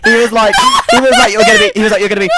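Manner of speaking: high, loud, breaking into laughter; can barely get it out for laughing; laughing fit. Real, not acted. Laughing fit while quoting driver.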